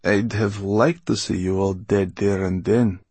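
put-on old villainess in a character voice; menacing old Mortola. A narrator's reading. Mortola to prisoners she wished dead, menacing. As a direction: coolly threaten the prisoners as the old woman.

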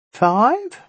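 high, squeaky little imp; squeaky nasal voice. A narrator's reading. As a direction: squeak it out through the nose. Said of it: "squeaky nasal voice".

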